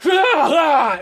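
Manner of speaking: high, loud battle cries; attack cry. Acted. Game master as a spirit slicing at an enemy, attack cry. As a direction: yell with each sword swing.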